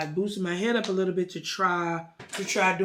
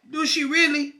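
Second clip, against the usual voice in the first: higher, louder mock doubt; retold challenge in a raised, doubting voice; pointed skepticism; 8 semitones higher, 5 dB louder than usual. Therapist pressing client about his wife, skeptical.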